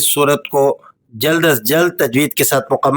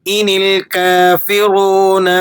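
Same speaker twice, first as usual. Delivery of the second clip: measured, melodic tajweed recitation; Quran recitation; 6 semitones higher, slower than usual. Acted. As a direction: recite the verse slowly and melodically. (تجوید کے ساتھ تلاوت)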